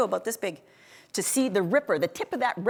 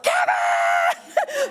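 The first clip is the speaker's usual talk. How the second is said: loud yell of Kevin's name; 13 semitones lower, 5 dB louder than usual. Retold or imitated. Going down the cliff, yelling for Kevin. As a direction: call for help down the cliff. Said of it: "I yelled".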